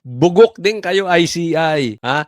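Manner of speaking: ordinary delivery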